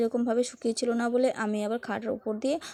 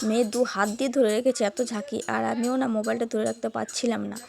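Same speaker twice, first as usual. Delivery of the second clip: normal talking voice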